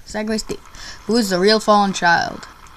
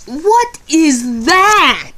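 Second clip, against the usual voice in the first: high, very slow and drawn out; appalled disbelief; 6 semitones higher, much slower than usual. Real, not acted. Gamer seeing a drawing supposed to be Sans, appalled. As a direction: stretch each word in exaggerated shock. Reacting to strange picture.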